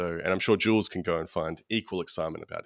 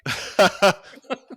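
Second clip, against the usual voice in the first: loud, high burst of laughter; real laugh; 10 semitones higher, 8 dB louder than usual. Real, not acted. Host hears dog chew-toy joke, loud laughter. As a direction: burst out laughing at a silly suggestion.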